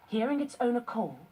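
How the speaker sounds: ordinary delivery, nothing marked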